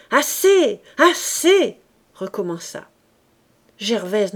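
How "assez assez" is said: high, loud shout to stop; crowd's cry. A narrator's reading. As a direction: cry out in protest, each word punched. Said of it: "Mais bientôt le cri". (cri de protestation)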